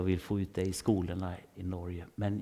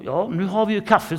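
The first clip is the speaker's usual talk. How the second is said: much higher, louder cheery boss voice; hammed-up boss; 10 semitones higher, 12 dB louder than usual. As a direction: announce the coffee like an overeager boss. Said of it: "säger personalchefen". (mycket högre, högre röst, överdriven glad chef)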